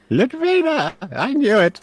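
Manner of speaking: put-on high, delighted old man's cry; elderly magister, gleeful and high-pitched; old professor, grinning. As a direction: exclaim it high and loud like a pleased old professor. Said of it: "he declares with a grin".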